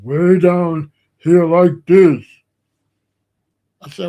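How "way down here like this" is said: loud, slow put-on voice; booming, drawn-out imitation of a friend's way-down voice; Thunder. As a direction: drag it out heavily, imitating a dominant talker. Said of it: "He used to talk".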